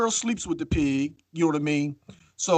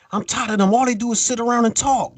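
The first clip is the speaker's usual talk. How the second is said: higher-pitched mock grumble of an exasperated wife; raised-pitch imitation of griping; imitated fed-up complaint; 7 semitones higher than usual. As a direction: complain like a wife who's sick of watching them. Wife's complaint acted out.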